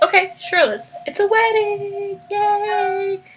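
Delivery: high singsong cheer; mock celebration. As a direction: announce it gleefully, stretching out the end. Voicing the royals giving in happily.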